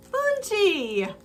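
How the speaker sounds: hammed-up friendly hello, then slowing; mock cheerful greeting. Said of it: "he goes".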